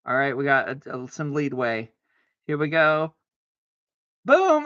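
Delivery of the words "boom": much higher, loud victory yell; triumphant boom. Real, not acted. Landing the move with a high loud yell. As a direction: shout it like a winner.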